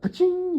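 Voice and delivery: a sudden high pa-ching, like a spring popping; springy pa-ching sound. Retold or imitated. Telling about trick teeth that spring out, a high cartoon pa-ching. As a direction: pop the sound out high and loud.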